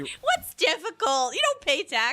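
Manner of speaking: mock exasperated. Mom mocking kid's complaint.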